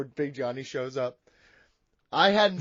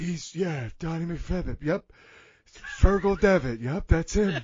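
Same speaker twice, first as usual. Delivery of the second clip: Big Johnny voice; 2 semitones lower than usual. Acted. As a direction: lecture him in character, cocky. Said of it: "but Big Johnny".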